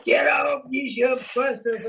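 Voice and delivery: high, half-laughing mock rant; hammed-up telling-off, shooing her out; mock furious yelling. Husband chasing his wife out of the room, mock-furious and laughing. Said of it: "I says".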